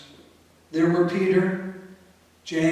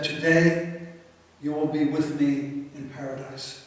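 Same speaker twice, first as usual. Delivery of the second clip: soft, lower reassurance; Jesus reassuring the dying thief; tender promise; 3 semitones lower, 4 dB quieter than usual. Acted. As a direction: comfort him gently.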